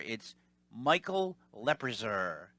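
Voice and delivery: "Lebrazer" said slowly and carefully; mispronounced name. Retold or imitated. Telemarketer slowly repeats the mangled name "Lebrazer". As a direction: stretch out "Lebrazer". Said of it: "She's like".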